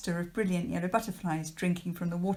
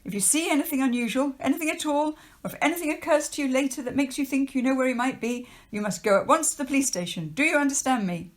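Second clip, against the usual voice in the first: higher, louder, fast stern official warning; 7 semitones higher, 4 dB louder, faster than usual. Acted. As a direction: lecture the children like a pompous official. Trapwood warns the children.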